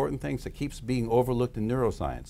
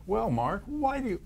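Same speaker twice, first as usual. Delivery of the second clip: mock interviewer; 10 semitones higher than usual. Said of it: "you interview them as if".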